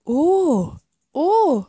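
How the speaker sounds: high, swooping "oh"s; taken aback, pitch arching up and down; bashful surprise. A narrator's reading. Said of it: "errötete der Oktopus".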